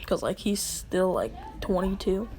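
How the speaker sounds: ordinary delivery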